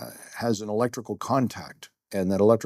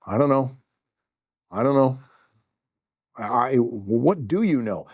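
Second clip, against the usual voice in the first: flustered; 3 semitones higher, 4 dB louder than usual. Retold or imitated. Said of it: "and I said".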